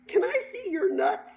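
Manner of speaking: put-on high, loud girlish blurt; daughter blurting a question. Acting out daughter's awkward question.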